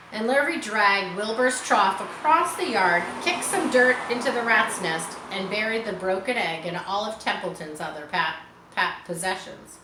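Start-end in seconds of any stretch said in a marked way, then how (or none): none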